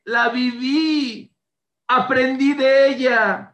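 slow, high, loud tearful prayer; crying out thanks. Retold or imitated. Grieving father thanking God in a loud, slow cry. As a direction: pour out the thanks at full voice, slowly. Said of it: "Empezó a gritar".

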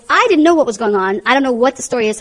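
ordinary delivery

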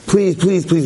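put-on a light pleading child, up in pitch; nagging kid. Boy nagging his father for a new bike, pleading. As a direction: beg over and over like a nagging boy. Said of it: "he nagged him".

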